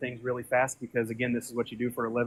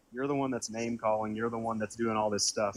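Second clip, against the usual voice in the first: ordinary delivery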